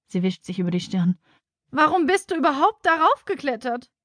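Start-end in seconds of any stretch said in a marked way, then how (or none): 1.7-3.9 higher-pitched exasperated teacher; distressed questioning. A narrator's reading. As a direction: ask in helpless dismay, pitch up. Distressed teacher questions stuck child. (aufgeregt, mit hoher Stimme)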